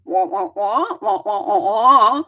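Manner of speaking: muted trombone wah-wahs; droning wah-wah babble, swooping up and down; Charlie Brown teacher. Retold or imitated. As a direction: imitate speech as wordless honking syllables. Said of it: "the Charlie Brown teacher".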